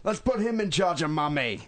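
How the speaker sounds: lightly hammed Australian bloke; a hint of a put-on Australian accent; bad Australian accent. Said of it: "worst accents humanly possible".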